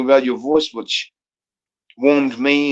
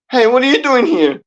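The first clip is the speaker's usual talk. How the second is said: mock relative; 10 semitones higher, 3 dB louder than usual. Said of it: "they're clinging on to me going".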